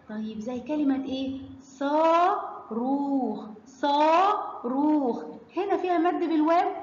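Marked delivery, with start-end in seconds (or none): 1.6-5.2 very slow صاروخ, both saa and rookh stretched; long vowel demo. Shown as a demonstration. Teacher models a madd word for pupils, drawn-out صاروخ. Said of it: "هيبقى صوته إيه؟".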